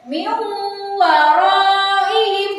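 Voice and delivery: slow, sustained "Min waraihim", nun hidden in the hum; merged nun recitation. Shown as a demonstration. Teacher repeats the right reading, slow merged chant. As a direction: chant "Min waraihim" with the n melted into a long hummed w. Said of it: "Seperti itu ya cara bacanya".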